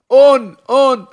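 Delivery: loud high call of "On, on!"; shouting. Retold or imitated. Korach's men shouting for On at the tent, loud and high. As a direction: yell out to summon him. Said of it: "התחילו לצעוק".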